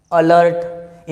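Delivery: normal talking voice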